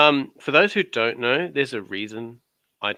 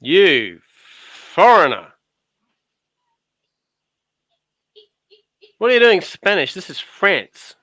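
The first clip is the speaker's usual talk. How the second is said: loud, higher, hammed-up French official; mock border guard; 5 semitones higher, 7 dB louder than usual. Mocking his cohost, a loud pompous French border guard.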